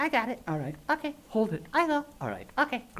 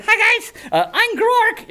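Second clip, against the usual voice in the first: high, loud puppet dragon; chirpy little dragon saying hi; silly puppet creature; 13 semitones higher, 5 dB louder, slower than usual. Acted. Puppet dragon introduces himself.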